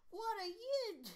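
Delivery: cut-off attempt at a high British voice; British accent retry. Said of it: "I should".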